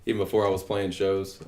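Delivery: normal talking voice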